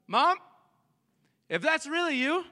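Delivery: high-pitched, wary call through a door; mock doubtful questioning. Son at the peephole to his mom, mock doubtful. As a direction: ask it high, as if unsure who's there. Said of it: "you ask questions like this".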